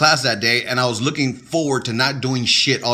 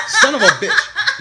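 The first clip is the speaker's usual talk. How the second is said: much higher, laughing through the curse; real laughter breaking into the words; 4 dB louder, slower than usual. Real, not acted. Husband laughing at his wife's teasing, high laughing protest.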